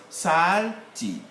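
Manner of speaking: "salted" slowly as SAL ... tid; ending as TID. Shown as a demonstration. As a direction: separate "salted" before a clear "tid". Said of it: "the syllable is formed by T I D".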